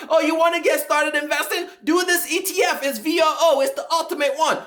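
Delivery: high, eager hype-man voice with a laugh; mock the excited guy pushing VOO; imitating an overexcited investing fan; hyped-up investing bro. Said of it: "heard from somebody talking about".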